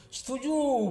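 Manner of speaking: high drawn-out cheer, falling at the end; crowd's assent. A narrator's reading. Villagers agreeing to Boyiman's proposal, high crowd cheer. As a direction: answer as a whole crowd, high on "Setuju". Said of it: "masa penduduk bilang".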